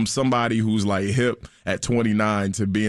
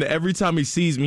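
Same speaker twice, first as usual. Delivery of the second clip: ordinary delivery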